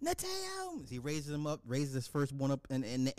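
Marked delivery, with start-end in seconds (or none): 0.1-0.9 thin, high, soft ceremonial call; Lion King proclamation. Retold or imitated. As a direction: proclaim the name, echoing far higher and softer. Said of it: "the whole Lion King".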